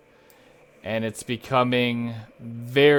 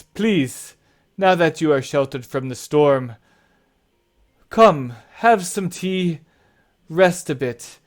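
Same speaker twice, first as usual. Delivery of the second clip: loud, higher, inviting voice; hospitable big host; 4 semitones higher, 6 dB louder than usual. Acted. Host offering tea after the storm, hearty welcome. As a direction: usher weary guests in heartily.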